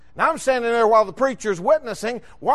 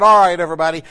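retold pastor rallying the group, louder and lower; calling out; 5 semitones lower, 5 dB louder, faster than usual. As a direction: call everyone together firmly. Pastor calling group together to pray.

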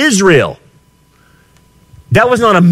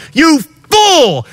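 angry rebuke; 10 semitones higher than usual. Acted. Preacher rebukes the complacent.

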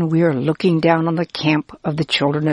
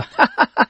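short, higher acted laugh; story laugh; 9 semitones higher than usual. A narrator's reading. Listeners joining the man in laughing, acted laugh. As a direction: laugh along briefly, pitched up. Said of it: "Then we laugh too".

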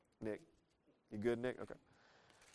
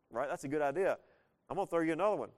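ordinary talk, nothing marked

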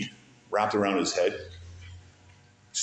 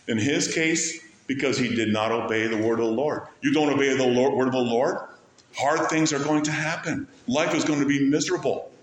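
ordinary delivery

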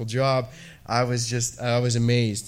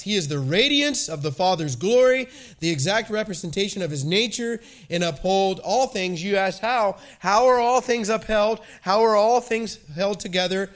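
raised, fervent pulpit voice; preaching; 6 semitones higher than usual. Real, not acted. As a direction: preach it with full conviction. Preaching on Hebrews.

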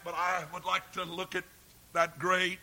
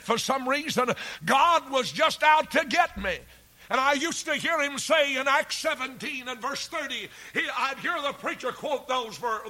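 higher and louder sermon voice, pressing hard; fiery preaching; 6 semitones higher, 9 dB louder than usual. Acted. Preacher recalling a stern sermon on repentance, fiery. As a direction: hammer the warning home.

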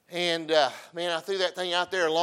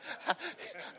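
breathy laugh; 6 semitones higher, 12 dB quieter than usual. Real, not acted. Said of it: "I'm laughing".

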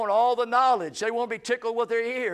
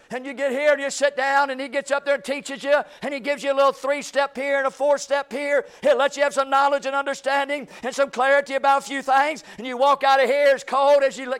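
raised preaching voice, driving each line home; preaching to the congregation; 6 semitones higher, 6 dB louder than usual. Acted. Preacher to his congregation, a raised, driving preaching voice. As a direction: lean into it like a pulpit sermon.

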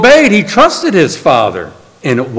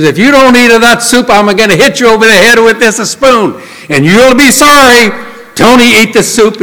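raised, higher, louder threat of a spanking spoon; threatening mother; 6 semitones higher, 3 dB louder than usual. Acted. Italian mother threatening her son over soup, angry raised voice. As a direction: scold him like an Italian mama brandishing a spoon. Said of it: "threatens him".

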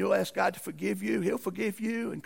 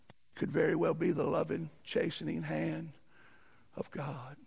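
hushed, tender; 3 semitones lower, 6 dB quieter than usual. Real, not acted. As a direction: lower voice fading to near whisper. Softening after the loud rebuke.

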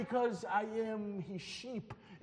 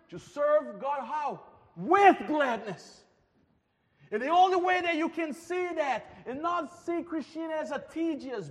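higher and louder, urgent pulpit calls; preaching at full force; 4 semitones higher, 5 dB louder than usual. Acted. Preacher urging the church to see God's beauty, fervent and loud. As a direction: rouse the congregation to joyful worship.

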